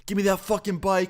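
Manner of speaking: high-pitched mock yell; imitated yelling. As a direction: imitate an angry kid yelling through a door. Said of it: "starts yelling through it".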